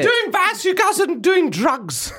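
high, loud nagging mum. Pretend. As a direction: scold him like a fussy mum. [name] plays Stuart's mum.

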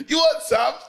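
football hooligan taunt. Retold or imitated. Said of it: "I was one of them".

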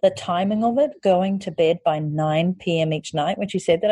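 ordinary talk

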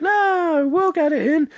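very high-pitched mock protest; high put-on voice of generous mates; imagined generous reply. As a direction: wave it off in a squeaky high voice. Acting out friends' expected generous reply.

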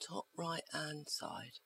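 sighing, slow whisper. Real, not acted. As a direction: breathe it out, weary. Weary repeat after a sigh.

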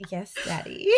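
mock swooning. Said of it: "you hear that and you're like".

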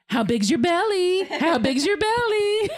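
grandma cooing at the belly, laughing; cutesy baby talk. Retold or imitated. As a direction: coo at the pregnant belly. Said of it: "I'm always like".

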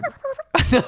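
high wailing loon imitation; loon call. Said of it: "I can do a loon call too".

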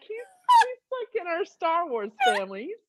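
high, flustered excuse; high embarrassed explanation. Retold or imitated. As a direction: stammer it out, high and caught out. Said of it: "And I'm like".

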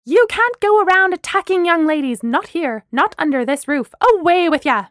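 loud, high scolding yell; outraged screaming. Acted. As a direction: shout him out of the building. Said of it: "Danny screamed".

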